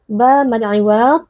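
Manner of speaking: ordinary talk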